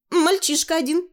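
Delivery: put-on high girlish reveal; high-pitched young girl's answer; coy girl. Tanya answers her mother.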